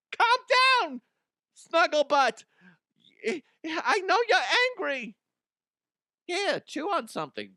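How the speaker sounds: high, cooing dog-owner voice; baby the dog like the owner does; high-pitched owner fussing over her pet; mock doting owner. Mocking owner talking to dog.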